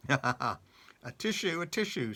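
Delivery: the pig sneezes after being tickled on the nose; sneezing. A narrator's reading. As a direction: sneeze out each tissue, loud. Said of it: "sneezed the pig".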